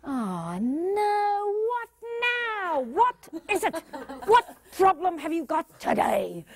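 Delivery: high, weary, half-laughing groan; exasperated inner voice. Retold or imitated. Manager dreading a difficult team member, exasperated. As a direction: sigh at the problem person. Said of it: "If you're saying".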